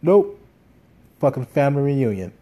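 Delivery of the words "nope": sharp, high, loud nope; emphatic nope. Real, not acted. Recalling the missed [name] show, loud flat denial. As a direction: slam the door on it with one word. Denied his first concert.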